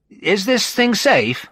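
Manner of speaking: high, slow, anxious question; nervous. A narrator's reading. Narrator asks in the swaying cable car, nervous. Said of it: "made me feel distinctly nervous".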